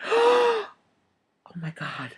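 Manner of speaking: high shocked oh, then a whispered my God. Retold or imitated. Fortune teller stunned by the child's palm. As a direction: gasp it out in awe. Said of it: "her eyes get real big".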